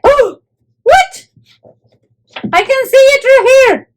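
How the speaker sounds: high-pitched, amazed outburst; high, astonished exclamation at a discovery; excited surprise. Real, not acted. Surprised by next item in box.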